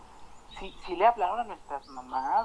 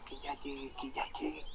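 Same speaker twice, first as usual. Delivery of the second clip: impatient mutter; 3 semitones lower, 14 dB quieter than usual. Retold or imitated.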